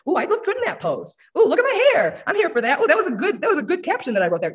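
high, delighted self-admiring coos; mock vain delight, squealing at your own hair; admiring yourself. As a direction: gush over your own selfies. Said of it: "You're excited to, like".

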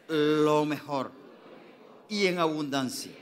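slow, loud prayer said for all to repeat; congregation prayer lead. Shown as a demonstration. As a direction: lead the church in prayer, word by word. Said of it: "diga conmigo".

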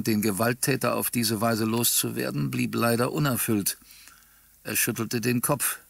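plain reading voice

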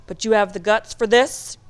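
loud demanding question; loud gruff challenge; barked challenge. A narrator's reading. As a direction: test his nerve, loudly. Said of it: "Mister Rickey barked".

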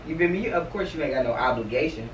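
ordinary delivery

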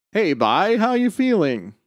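high, lilting hello from a dad; caring dad's greeting. Acted. Dad greets teenage son.

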